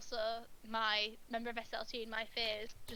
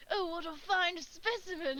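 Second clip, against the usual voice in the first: high, slow posh nobleman; 6 semitones higher, 3 dB louder than usual. Retold or imitated. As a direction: play a grand, fawning nobleman. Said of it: "the posh noblemen voices".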